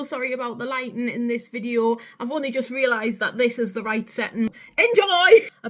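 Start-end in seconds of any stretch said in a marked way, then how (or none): 4.8-5.5 high, very loud send-off; shouted sign-off. Pretend. Vlogger sending viewers into the video, shouted sign-off. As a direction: belt it out to the audience.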